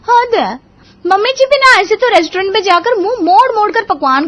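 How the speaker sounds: high, loud, exasperated bahu; high-pitched theatrical kitchen rant; grumbling daughter-in-law. Acted. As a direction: complain shrilly about your mother-in-law. Bahu grumbles about mother-in-law.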